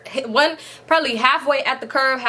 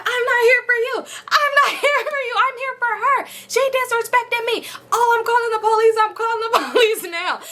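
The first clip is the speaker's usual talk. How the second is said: much higher, laughing caricature of the offended woman; mock shrill outraged lady; 11 semitones higher than usual. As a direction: ham up the lady crying foul. Punched lady protests, threatens police.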